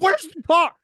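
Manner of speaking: sudden high yelp of alarm; panicked shout. Acted. Teen yelling at parents about the missing car. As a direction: blurt it out in a panic.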